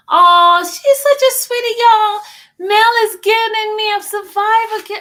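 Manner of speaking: high, loud gushing over a sweet gift; gushing delight. Real, not acted. Thanking Mel on stream for a survivor kit, high and gushing.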